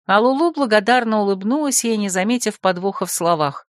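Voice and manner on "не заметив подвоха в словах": ordinary delivery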